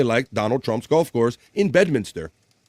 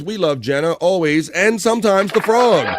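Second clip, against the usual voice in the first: playful read; 6 semitones higher, 4 dB louder than usual. Acted. Reading a fan's playful comment.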